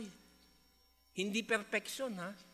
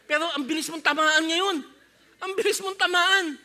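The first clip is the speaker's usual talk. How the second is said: louder emphatic preaching; 5 semitones higher, 7 dB louder than usual. Real, not acted. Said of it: "preacher stresses point".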